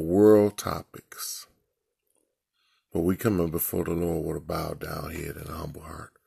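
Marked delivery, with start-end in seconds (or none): 4.4-6.1 hushed whispered prayer; whispering. Real, not acted. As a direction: whisper it with a bowed head.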